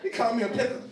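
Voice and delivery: mock offended, loud and laughing. Real, not acted. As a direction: play outraged while cracking up.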